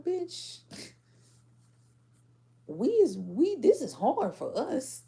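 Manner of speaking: high, loud exasperated "bitch" with a laugh; playing the overwhelmed friend, laughing; high, loud, laughing exasperation; exasperated mock quote. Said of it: "And somebody's like".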